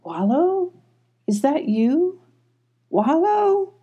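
high, tentative questioning calls; shy voice. A narrator's reading. Popper answers from the trees, shy and high. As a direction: float each question up timidly. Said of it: "they heard a shy voice".

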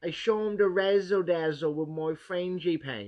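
slow, hammed-up fake posh British accent; bad British accent. Showing off his baking to a judge in bad British accent. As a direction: lay on a terrible British accent, slowly. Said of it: "It's terrible".

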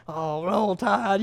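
low, soft, slow Roll Tide; grudging. Retold or imitated. Rival fan reacting badly, low grumbling. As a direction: drag it out, unhappy. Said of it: "whether it be bad".

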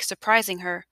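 ordinary delivery

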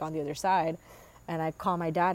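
ordinary delivery

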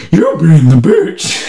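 lightly voiced dog, drawn out; talking dog. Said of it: "because he's a dog".

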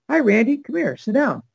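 a sweet, gracious lady calling him over; Linda Lee's greeting. Retold or imitated. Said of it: "And she goes".

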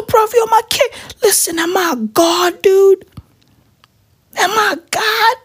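hammed-up tearful awe; fake-crying proud father; mock crying.

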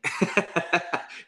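a quick, high bright laugh; high burst of laughter. Real, not acted. Laughing while telling his guitarist it's [name], high laugh.

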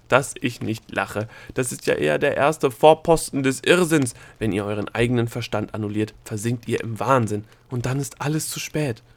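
scoff, then push the warning out hard; scornful Nachtmar. Acted. Nachtmar warning them of impending madness, scornful.

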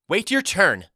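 put-on loud, high-pitched girl's snap; barked order. A narrator's reading. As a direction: bark it through the outhouse door. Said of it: "the girl inside barked".

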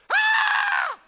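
long, high, loud scream; hammed-up high-pitched shriek of terror; terrified scream. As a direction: shriek like you've seen a ghost. Acts out troubled disciples.

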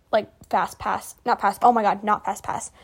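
ordinary talk, nothing marked

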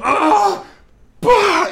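sharp yell then a loud smack noise; mock grunt and pah of a fist on a wall; punch sound effects. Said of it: "Efectos especiales".

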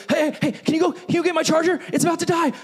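whiny phone plea. Retold or imitated. Preacher mocking someone begging for a charger, high whine. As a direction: beg a family member to fetch it. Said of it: "pawn that job off".

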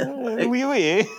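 high, playful French oui; playing a French chef; French accent. Joins the French bit.

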